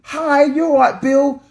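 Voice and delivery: high, put-on polite office voice; chirpy fake-friendly colleague greeting; posh work voice. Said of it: "we're at work and we're like".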